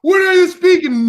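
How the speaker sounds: high, loud, incredulous question; exaggerated baffled asker; baffled questioner. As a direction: raise the pitch and demand it loudly. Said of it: "They're gonna be like".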